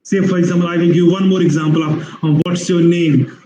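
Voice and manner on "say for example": normal talking voice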